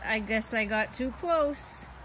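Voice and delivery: usual speaking voice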